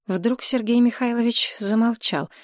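ordinary delivery

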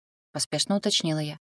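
ordinary delivery

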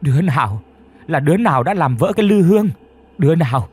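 loud, high grieving howl; screaming wail. A narrator's reading. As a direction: howl at the broken urn. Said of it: "tiếp tục gào rú".

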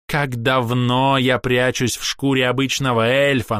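haughty elf, high ringing voice; theatrical, scornful ranting; proud elf. Acted. Castiel reveals himself.

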